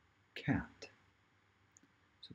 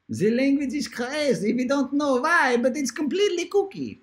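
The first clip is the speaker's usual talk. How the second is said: very high, silly put-on voice; hammed-up high crazy voice; kooky comic voice; 5 dB louder than usual. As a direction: squeal it out in one high, goofy pitch. Clowning about crazy English spelling.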